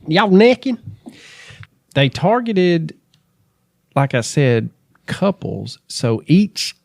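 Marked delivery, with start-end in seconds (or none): 0.1-0.8 higher Southern twang, rising on "necking"; teasing hillbilly question; mock country accent. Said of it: "In, in the country?".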